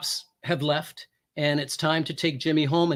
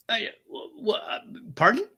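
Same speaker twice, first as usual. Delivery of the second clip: flustered surprise; 6 semitones higher than usual. Retold or imitated. Officer invited up to meet the fiance, flustered stammer. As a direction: stumble over the words, taken aback.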